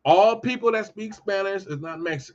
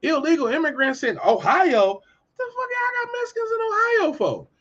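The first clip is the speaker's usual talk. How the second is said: much higher, squeaky mock voice; hammed-up high incredulous voice; mocking a baffled person; 7 semitones higher than usual. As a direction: play a shocked bystander in a put-on high voice. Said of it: "you be like".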